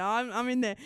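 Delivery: usual speaking voice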